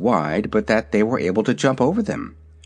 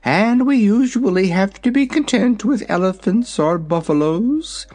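regretful dragon; 9 semitones higher than usual. A narrator's reading. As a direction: sigh it out like a sorry little dragon. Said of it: "in a regretful tone".

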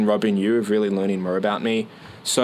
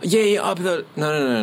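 flustered surprise; 7 semitones higher than usual. Retold or imitated. Mimics flustered reply.

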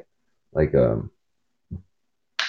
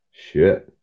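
ordinary talk

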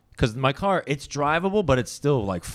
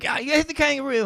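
very high mock Aussie voice; cartoonish high Australian accent; Australian lady cop; faster than usual. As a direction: squeak it out as an Australian policewoman. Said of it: "It's an Australian lady cop".

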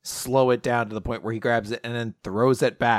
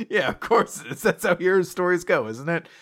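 usual speaking voice

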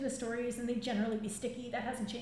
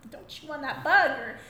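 scolding grandmas; 2 semitones higher, 9 dB louder than usual. Retold or imitated. Grandmas watching babies eat everything, mock scolding. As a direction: start mild, build to alarmed mock scolding. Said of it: "the grandmas would be like".

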